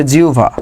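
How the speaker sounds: জিহ্বা said as "jiu-va"; correct pronunciation. Shown as a demonstration. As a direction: turn the hb of জিহ্বা into a u glide plus v. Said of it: "কি হবে".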